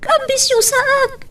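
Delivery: high, tearful protest; crying out in hurt. Acted. Tearful quarrel over marriage.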